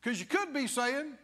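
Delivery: ordinary talk, nothing marked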